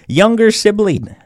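usual speaking voice

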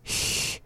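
unvoiced "h" hissed like a cat. Shown as a demonstration. Teacher showing learners the first sound, "h" as a cat-like hiss. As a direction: hiss the "h" softly, as at the start of human. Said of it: "that of a cat hissing".

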